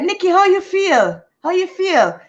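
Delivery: friend's amused question; teasing friend. Retold or imitated.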